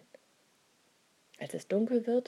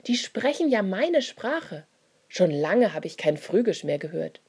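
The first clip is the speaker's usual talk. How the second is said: louder, amazed call; calling loudly; 8 dB louder than usual. A narrator's reading. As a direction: shout across the street in wonder. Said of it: "Einer ruft ganz laut". (laut und staunend gerufen)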